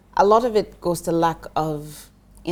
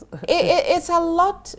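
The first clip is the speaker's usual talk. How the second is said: real laugh breaking through, pitched much higher; 12 semitones higher, 5 dB louder than usual. Real, not acted. Guest laughing off the host's "it's a lot", laughing. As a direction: giggle the words out while conceding.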